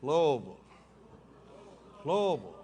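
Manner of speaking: Louisville said "Lovel", falling; teaching the crowd to say Louisville "Lovel"; local Louisville. Shown as a demonstration. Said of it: "It's pronounced Louisville".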